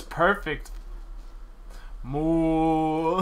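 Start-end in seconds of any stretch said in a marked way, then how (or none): 2.0-3.2 long, drawn-out moo; lightly imitated cow; playful cow moo. Imitates cow after becoming one.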